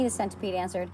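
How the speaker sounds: ordinary delivery, nothing marked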